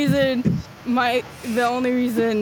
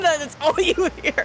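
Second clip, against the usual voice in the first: usual speaking voice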